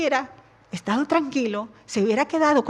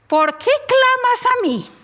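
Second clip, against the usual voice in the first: rebuke; 11 semitones higher, 4 dB louder than usual. Acted. God rebukes Moses.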